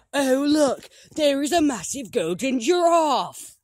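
high, loud amazed cry; excited shout. Pretend. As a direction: shout in wonder at the giraffe. Spotting a giant golden giraffe.

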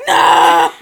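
loud laughing yell of no; laughing shout. Retold or imitated. Friends laughing at the typo writer's panic, laughing shout.

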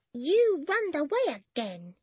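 put-on high, slow child's voice; small boy scolding. A narrator's reading. Small boy scolds his piglet, small child's voice. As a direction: scold a pet as a tiny child. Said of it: "said the small boy".

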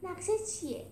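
high, upbeat question; happily asking. Acted. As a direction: ask eagerly for the plan. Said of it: "با خوشحالی پرسید".

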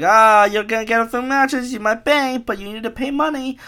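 high-pitched put-on salesman; mock sales pitch. Dating app pushing the user to pay, high mocking pitch.

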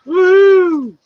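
high, loud whoop; a teasing whoop at the cohost's jab; mock cheer. Real, not acted.

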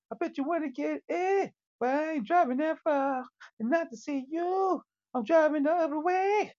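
high, sassy boasting rant; sing-song boast. Pretend. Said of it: "I'm like".

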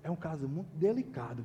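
low, soft voice behind a closed door; hushed confiding. Retold or imitated. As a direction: act out an embarrassed, confidential admission.

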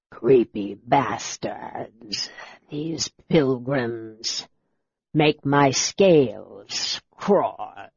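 a scaly soldier grumbling low and slow; lizard man voice. Acted. Draconian commander speaks.

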